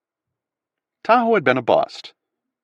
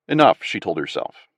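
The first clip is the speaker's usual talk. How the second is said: plain reading voice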